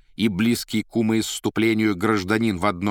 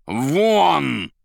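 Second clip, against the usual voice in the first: loud, high, drawn-out order; shout; 8 semitones higher, 7 dB louder than usual. A narrator's reading. Azazello banishing the informer, loud shout. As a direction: shout him out of the room. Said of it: "и крикнул".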